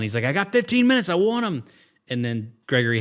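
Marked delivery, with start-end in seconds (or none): 0.2-1.6 high, loud mock drill-sergeant holler; hammed-up yell, much higher and louder; imitating a yell. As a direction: bark it like a tiny tyrant shouting up a tower. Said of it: "he's, like, yelling".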